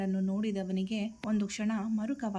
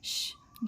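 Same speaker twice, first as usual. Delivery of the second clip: gentle unvoiced shh; soothing shh to a crying friend; soft shush; 5 dB quieter, much slower than usual. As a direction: hush her softly, like calming a child. Hushing his crying friend.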